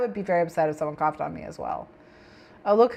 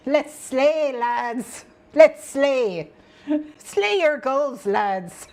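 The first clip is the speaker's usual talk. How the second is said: higher, louder hammed-up Scottish rallying cry; rally the lads in put-on Scottish; mock Scottish accent; 5 semitones higher, 3 dB louder, much slower than usual. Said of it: "I need to do that accent".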